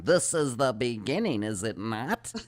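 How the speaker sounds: channeler voice. Retold or imitated. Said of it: "goes into this real monotone voice".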